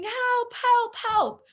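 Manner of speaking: mock scream for help. Kid fallen in a hole calling for help, high mock cry. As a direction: yelp it like a child stuck in a hole. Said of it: "starts screaming".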